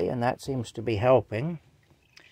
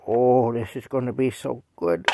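normal talking voice

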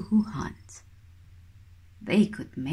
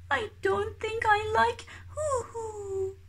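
high, softer tearful wail; crying chick; 14 semitones higher, 6 dB quieter than usual. Acted. Baby kiwi refusing even hoo hoo, high tearful wail. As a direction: sob it out like a heartbroken baby bird.

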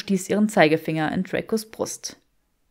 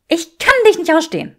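much higher, loud, stress on kann; woman declaring she can't stand him; Granger's hatred; 9 semitones higher, 9 dB louder, faster than usual. Acted. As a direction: punch kann, pitched high. Granger's voice in quarrel.

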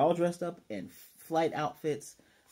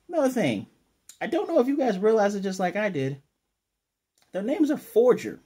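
excited; 9 semitones higher, 7 dB louder than usual. Real, not acted. Excited reveal to viewers.

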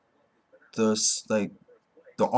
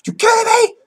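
much higher and louder, cracking yelp; outraged cry; 9 dB louder than usual. Retold or imitated. Genos outraged at the simple training, crying out. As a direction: shriek the question in disbelief. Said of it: "kinda, like, salty".

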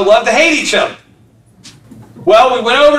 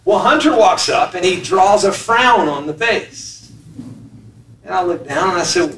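usual speaking voice

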